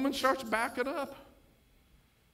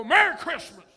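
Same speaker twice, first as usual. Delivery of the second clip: emphatic merry christmas; 6 semitones higher, 10 dB louder than usual. Retold or imitated. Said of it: "I go".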